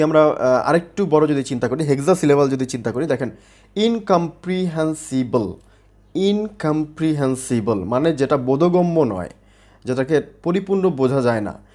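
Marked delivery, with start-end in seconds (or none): 3.8-5.5 ইনকম্প্রিহেন্সিবল slowly, in six separate syllables. Shown as a demonstration. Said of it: "ছয়টা syllable নিয়ে একটি". 6.1-7.8 ইনকম্প্রিহেন্সিবল slowly, in six separate syllables. Shown as a demonstration. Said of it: "ছয়টা syllable নিয়ে একটি".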